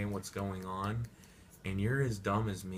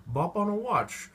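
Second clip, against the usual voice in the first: high, loud fake posh British nonsense; hammed-up British accent gibberish; mock British gibberish; 10 semitones higher, 6 dB louder, faster than usual. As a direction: parody Queen's English. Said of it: "He's like".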